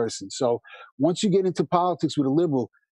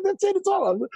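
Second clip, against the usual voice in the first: much higher, hammed-up wailing doom; mock despair. Retelling the cousin's panic, high hammed-up despair. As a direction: wail it like it's all over, laughing. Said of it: "I said".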